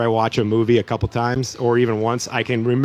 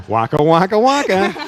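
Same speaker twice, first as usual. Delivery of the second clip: goofy, higher, louder Muppet bear catchphrase; Fozzie Bear; 7 semitones higher, 3 dB louder than usual. Retold or imitated. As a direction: ham it up like the Muppet bear cracking a joke. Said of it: "that was more Fozzie Bear".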